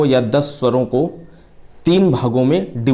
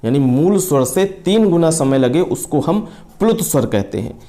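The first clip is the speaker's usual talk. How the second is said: usual speaking voice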